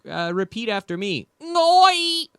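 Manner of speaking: "Ngoi" squeezed out high up in the nose; imitating Thai teacher. Thai teacher drilling him on sounds, high sharp Ngoi. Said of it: "she'd be like".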